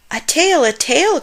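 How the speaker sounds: put-on boy spotting a tail, calling out excitedly; excited cry. A narrator's reading. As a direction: call out, thrilled. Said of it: "cried George".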